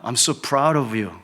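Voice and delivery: slow, drawn-out English praise; father proudly telling his daughter; tender praise. Retold or imitated. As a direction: say it slowly, letting each word land. Father praising daughter after test.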